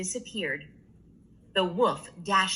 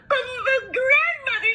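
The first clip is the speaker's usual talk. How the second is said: put-on very high frightened child; little girl voice. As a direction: squeak it in alarm. Little Red alarmed.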